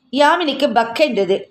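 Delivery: usual speaking voice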